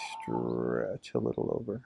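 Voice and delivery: long, sinking exercise cue. Real, not acted. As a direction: draw it out low as if easing into the stretch. Cueing a long stretch.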